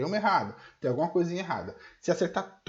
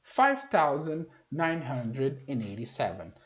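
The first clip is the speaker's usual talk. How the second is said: slow teaching read; 2 semitones lower, slower than usual. Shown as a demonstration. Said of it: "Então".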